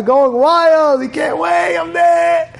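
high, loud party excitement; high-pitched, thrilled retelling; excited shout. Retold or imitated. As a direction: whoop it up at the bonfire. Acting out bonfire excitement.

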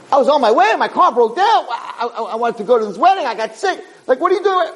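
high-pitched mock outburst of grievances; angry complaint, acted. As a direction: complain up at God in exasperation, half in play. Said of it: "he was getting angry".

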